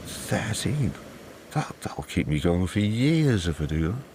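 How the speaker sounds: taken aback, voice lifting in disbelief; incredulous. A narrator's reading. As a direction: balk at the size of the demand. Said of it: "Angus can't believe it".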